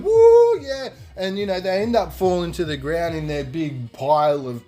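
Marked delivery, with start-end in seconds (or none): 0.0-0.9 high, loud party whoop; yelling and screaming. Retold or imitated. A big bunch of guys piling together, yelling and screaming. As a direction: imitate a rowdy crowd cheering. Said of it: "all yelling and screaming".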